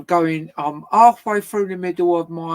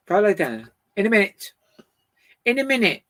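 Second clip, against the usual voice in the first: firm command to dog; 5 semitones higher, 5 dB louder than usual. Real, not acted.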